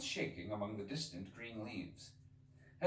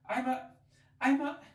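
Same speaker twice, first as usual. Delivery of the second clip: stammering Alice; 9 dB louder than usual. Acted.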